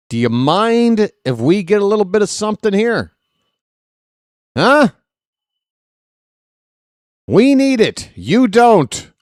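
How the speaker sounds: rising outburst, pleading with the other team; a fan losing it on air, half joking; emotional pleading. Real, not acted. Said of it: "I'm just a little emotional".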